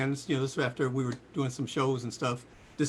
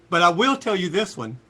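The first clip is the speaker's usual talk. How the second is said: normal talking voice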